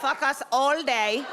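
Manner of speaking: put-on Israeli accent. Imitating her Kabbalah teacher with an Israeli accent. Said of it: "crazy Israeli accent".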